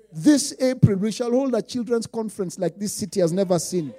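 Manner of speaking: ordinary delivery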